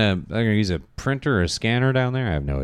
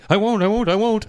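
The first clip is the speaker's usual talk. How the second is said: quick, high, nervous repeated promise; scared promising; 13 semitones higher than usual. Retold or imitated. Ryan promising Luke he won't tell, high nervous pleading. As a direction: blurt the promise over and over to calm him.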